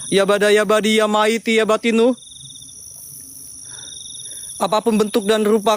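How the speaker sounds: solemn ritual chant; summoning incantation. Real, not acted. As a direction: call out to the spirits. (mantra pemanggilan yang khidmat)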